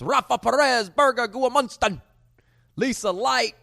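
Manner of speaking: loud, high, hyped-up yelling of names; hyped shout-outs. Real, not acted. Host excitedly yelling shout-outs to his viewers. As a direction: hype the crowd, shouting every name.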